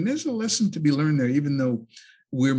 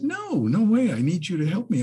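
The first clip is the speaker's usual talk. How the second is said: higher, insistent plea; pleading scorpion; 7 semitones higher, 4 dB louder than usual. Acted. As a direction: beg the frog to trust you. Scorpion voiced in a story.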